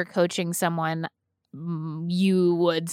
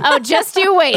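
mock threat; 10 semitones higher, 9 dB louder than usual. Playful mock threat after compliment.

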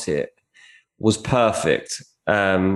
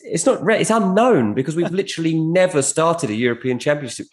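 excited; 7 semitones higher than usual. Real, not acted. Excited correction about England.

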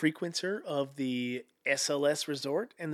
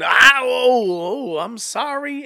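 mock apology; 7 semitones higher, 11 dB louder, much slower than usual. Acted apology after confrontation.

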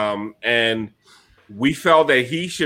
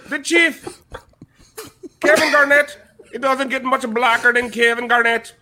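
higher-pitched Irish leprechaun voice; hammy stage-Irish leprechaun; Lucky the Leprechaun; 6 semitones higher than usual. Acted. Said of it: "exclusive with Lucky the Leprechaun".

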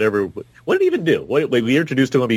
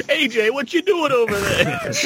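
mock Tony Soprano. Tony Soprano joke continues.

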